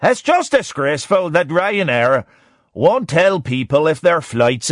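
put-on a light stab at an Irish accent; lightly voiced Irish gran, mock outrage; Irish accent attempt. Quoting an Irish gran complaining, a hint of Irish accent. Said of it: "I'm gonna have a stab at the accent".

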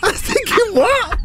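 high astonished what; disbelief. Real, not acted. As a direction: sound gobsmacked at the stupidity. Disbelief at racist tweet.

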